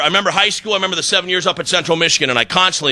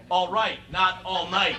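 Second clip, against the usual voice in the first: muttered aside; 11 dB quieter, slower than usual. Retold or imitated. Husband mumbling a cheeky reply to his wife, softly. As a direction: drop it under the breath, almost secretly.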